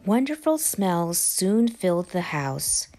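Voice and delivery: ordinary delivery, nothing marked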